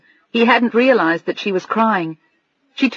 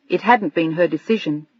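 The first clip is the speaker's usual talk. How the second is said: plain reading voice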